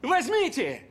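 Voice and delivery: yelling. Acted. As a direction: yell at him to take the money. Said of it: "Не ори на меня".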